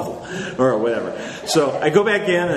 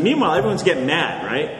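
normal talking voice

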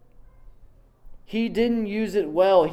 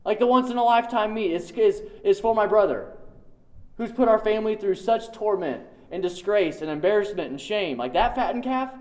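furious brother; 5 semitones higher, faster than usual. Retold or imitated. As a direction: play the jealous son fuming. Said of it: "can you imagine the furiousness of the brother".

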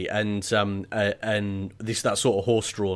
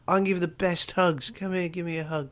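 a giddy many-armed creature begging for hugs; blissed-out alien; 9 semitones higher than usual. Acted. Martian gushing love at the humans, high and dreamy.

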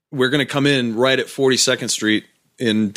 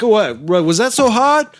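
high, louder mock sarcasm; sarcastic stranger; 11 semitones higher, 4 dB louder than usual. Stranger mocking the girlfriend, high sarcastic tone. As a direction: needle her for not knowing. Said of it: "He's like".

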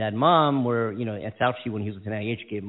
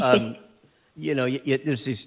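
ordinary talk, nothing marked

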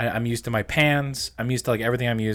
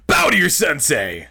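higher, louder sensei command; sharp martial arts commands; 5 semitones higher, 4 dB louder than usual. Retold or imitated. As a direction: snap it out like a drill. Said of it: "what that voice reminds me of".

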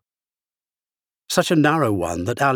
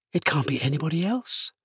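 softer, slightly raised voice; a private aside, higher and softer; talking to herself; 5 semitones higher, 5 dB quieter than usual. A narrator's reading. Alice tells herself who it must be, talking to herself. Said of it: "she said to herself".

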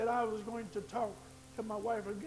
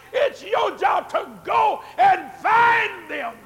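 louder, higher and slowed, building to a shout; slow preaching shout; 9 semitones higher, 15 dB louder, slower than usual. Acted. Pastor commanding members to seek out the lost, in a slow shout. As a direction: lay each phrase down hard and slow.

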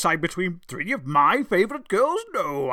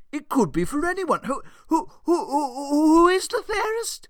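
climbing much higher, ending in a giddy laugh; hammed-up excitement; 11 semitones higher than usual. Zeus teases his goddesses about who is fairest, climbing to a laugh. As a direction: tease them, building to a squeal.

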